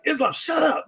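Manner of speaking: high, mocking snap; snarky mock retort. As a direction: toss it off high and snippy. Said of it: "He's like".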